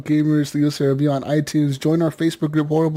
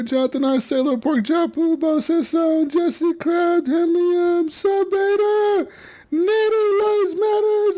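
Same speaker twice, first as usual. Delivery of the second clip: one breath; 10 semitones higher than usual. Shown as a demonstration. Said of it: "read that whole list in one breath".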